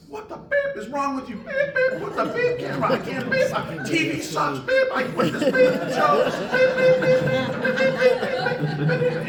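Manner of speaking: very high, loud hammed-up shrieking rant; mock furious tirade. Work wife cursing out the film crew, mock furious tirade. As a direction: imitate an outraged tirade, shrieking out each bleep. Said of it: "she went off on this tirade".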